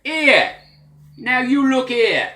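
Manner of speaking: loud, high Cockney shout; Cockney accent. Shown as a demonstration. Teacher demonstrating London's famous Cockney, loud Cockney accent. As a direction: call it out in broad Cockney, dropping the H. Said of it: "there is the famous Cockney".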